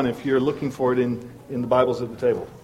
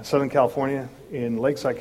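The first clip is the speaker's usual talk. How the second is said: ordinary delivery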